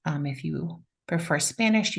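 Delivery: ordinary talk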